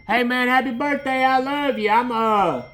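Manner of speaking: loud, high friendly call. Real, not acted. Preacher shouts birthday wishes to a friend outside, loud and warm. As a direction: shout happy birthday across the yard.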